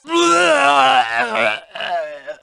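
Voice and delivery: big taunting laugh. Acted. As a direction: belly laugh at the victim. Loud laugh on its own.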